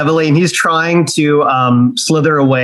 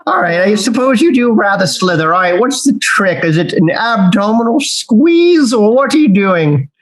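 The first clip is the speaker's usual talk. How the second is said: haughty snake; 5 semitones higher than usual. Acted. Snake asks in character, posh.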